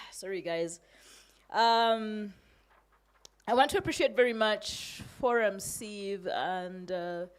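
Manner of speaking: pushing through tears of gratitude; emotional. Real, not acted. Poet thanking the hosts for affirming her work, emotional. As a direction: voice catching with real emotion, sighing first. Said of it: "I'm a bit emotional".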